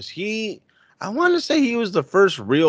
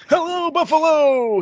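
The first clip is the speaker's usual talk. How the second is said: high, loud cheery shout to the crowd; excited babyface greeting; 5 dB louder than usual. Retold or imitated. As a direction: yell it like a grinning hero greeting his home crowd. Imitating MJF's babyface greeting.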